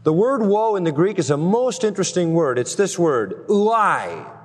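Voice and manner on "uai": drawn-out demonstrated cry, high then falling; painful guttural cry. Shown as a demonstration. Preacher showing the congregation the Greek word for woe, as a painful cry. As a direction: voice the word as a wailed "uai". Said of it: "sounds more like a painful, guttural cry".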